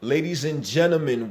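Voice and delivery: ordinary talk, nothing marked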